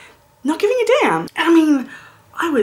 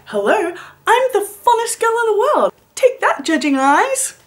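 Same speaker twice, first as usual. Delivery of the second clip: put-on cocky party girl; 11 semitones higher than usual. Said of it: "I was like".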